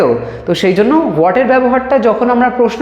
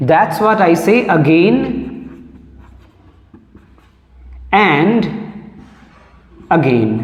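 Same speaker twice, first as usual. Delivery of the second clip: slow dictation; much slower than usual. Shown as a demonstration. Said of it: "teacher dictates phrase slowly".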